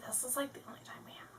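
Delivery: hushed confiding whisper; soft, unvoiced aside. Real, not acted. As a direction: drop to a whisper, as if admitting it.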